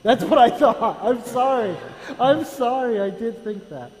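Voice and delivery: loud, laughing sorry; laughing apology. Real, not acted. Guest laughing as he apologises to the pastor.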